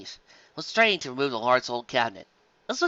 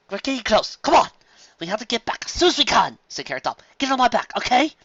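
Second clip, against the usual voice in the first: higher, hurried encouragement; urgent; 9 semitones higher, 4 dB louder than usual. Acted. Carrot Top urges Apple Bloom to hurry, higher. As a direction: hurry the foal along.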